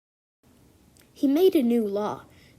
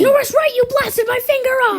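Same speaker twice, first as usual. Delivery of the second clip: pained yell; 13 semitones higher, 11 dB louder than usual. Acted. Wounded man screams at attacker.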